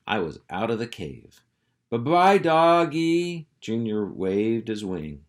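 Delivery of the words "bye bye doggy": put-on high, slow, louder baby buzzard. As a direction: drag it out sweetly like a young buzzard. Buzzard Junior says goodbye.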